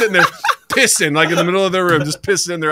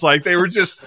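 ordinary delivery

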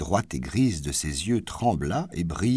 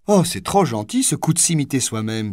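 bright exclamation, higher and louder; delighted exclamation; 6 semitones higher, 4 dB louder than usual. A narrator's reading. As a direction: clap and cry out with delight. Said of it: "s'écria-t-elle en battant des mains". (s'écrier, ravie, en battant des mains)

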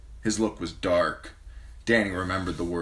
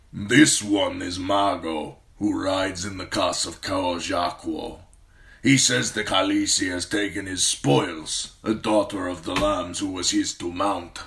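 weighty warrior's report, measured; blood rider speaking; 4 dB louder than usual. Acted. Blood rider translates the complaint.

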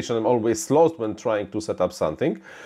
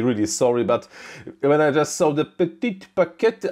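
normal talking voice

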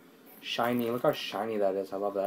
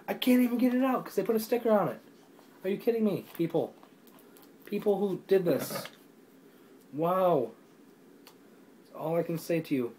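much higher and faster, in disbelief; real indignant disbelief at a stuck sticker; exasperated; 7 semitones higher than usual. Real, not acted. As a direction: scold the people who put the sticker on. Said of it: "It makes me mad".

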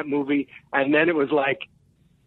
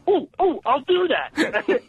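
high excited ooh-ooh, then a slow I'll do that; giddy eagerness; 8 semitones higher, slower than usual. Retold or imitated. Mimicking his friend volunteering to use the joke, giddy. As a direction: bounce with childish eagerness.

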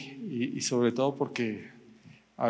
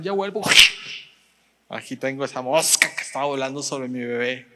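mock fierce mom; 5 dB louder than usual. Mother killing fly over baby.

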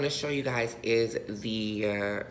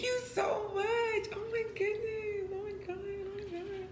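much higher squealing thanks, fading softer; excited squeal; 4 dB quieter than usual. Real, not acted. Squealing thanks to the friend who sent the gift. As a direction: squeal then melt into teary gushing.

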